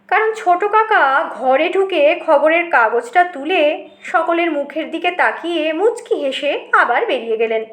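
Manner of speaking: ordinary delivery, nothing marked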